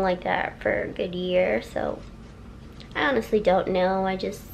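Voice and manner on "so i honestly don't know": ordinary delivery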